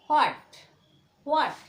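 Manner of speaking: व्हाट with silent h, like English what; व्हाट said as wat, no h sound. Shown as a demonstration. Teacher modeling व्हाट with silent h. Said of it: "क्या पढ़ोगे".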